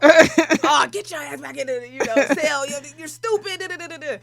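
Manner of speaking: doctor cussing out a patient, mock angry. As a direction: tell him off, half-laughing. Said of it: "now I'm cussing him out".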